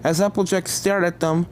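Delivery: usual speaking voice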